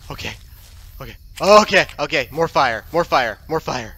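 ordinary talk, nothing marked

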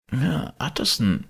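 put-on high, frightened old servant. A narrator's reading. Frightened old servant Poole speaks.

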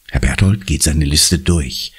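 ordinary delivery, nothing marked

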